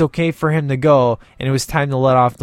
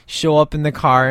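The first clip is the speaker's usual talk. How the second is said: ordinary talk, nothing marked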